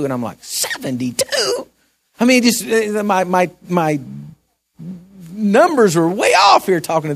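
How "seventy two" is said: high, slow incredulous echo; stunned question rising up high; shocked disbelief. Retold or imitated. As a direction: repeat it as if you can't believe it. Incredulous echo of her age.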